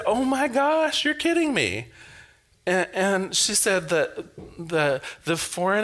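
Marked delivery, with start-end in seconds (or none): 0.1-1.9 retold surprise.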